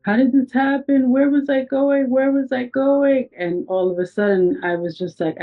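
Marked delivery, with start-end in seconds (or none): 0.0-3.3 imitated crying. Woman re-enacting her own mind after the accident, crying. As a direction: act out a crying voice, pitched well up. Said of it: "my voice is shaky, and my voice is crying".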